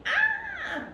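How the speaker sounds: very high frightened yelp; a sudden scared cry; startled shriek. Acted. Cub yelps as the animals scare him.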